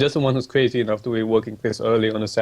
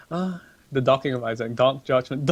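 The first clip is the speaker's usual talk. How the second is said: ordinary talk, nothing marked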